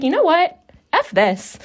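a sudden defiant outburst; mock decisive, done with it; fed-up declaration. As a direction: declare you're done with caring. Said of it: "I was just like".

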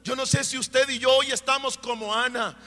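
loud, rising preacher's cry, voicing Hannah's prayer; preaching at full force. Preacher voicing Ana's grateful prayer to the priest, impassioned preaching.

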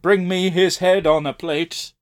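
slow commanding tyrant voice; hammed-up king demanding a head; roaring tyrant king. Quotes line dramatically, tyrant voice.

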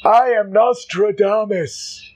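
grand prophet voice, high and slow; hammy stage actor. Acted. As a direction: announce yourself like a stage wizard. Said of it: "And he's like".

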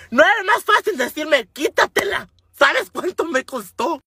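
high, exasperated scolding; indignant rant. Retold or imitated. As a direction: complain like you've been robbed. Venting at him.

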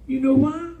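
high, shouted preacher's cry; preaching at full force. Real, not acted. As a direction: lift the church with a shout.

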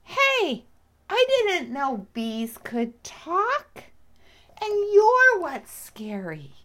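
high, loud, indignant little cub; lion cub character. Acted. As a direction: talk back as a surprised cub. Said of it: "and he said".

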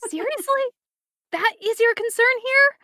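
high-pitched, voiced disbelief; incredulous hiss. A narrator's reading. Wally questioning Calder's concern, high and incredulous. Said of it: "Wally hisses".

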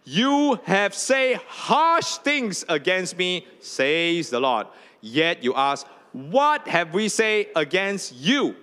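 reading scripture aloud. Real, not acted. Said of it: "read together out loud".

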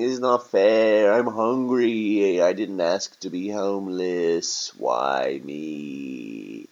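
loud, drawn-out mock wail, falling away; hammed-up whining lament; protesting homeless man. Homeless man cheated of his meal, loudly wailing.

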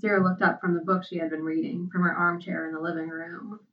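plain reading voice

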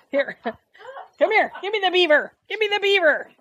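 high coaxing voice to dogs; talking to dogs. Real, not acted. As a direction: coax the dogs in a high sing-song voice.

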